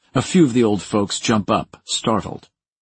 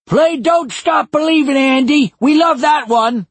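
high loud shout across the room; shouting old man; 8 dB louder than usual. Acted. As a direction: holler the request like a rowdy old man. Patron shouting request.

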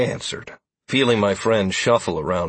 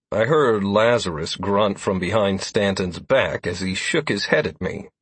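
ordinary delivery, nothing marked

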